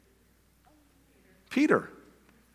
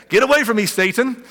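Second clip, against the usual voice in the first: higher, louder Get away from me; sharp rebuke; 8 semitones higher, 5 dB louder than usual. Retold or imitated. Jesus rebuking Peter, sharper mock rebuke. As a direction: snap the rebuke out.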